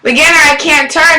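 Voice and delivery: mildly mock a warning shout; retold yelling. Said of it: "I was kept yelling".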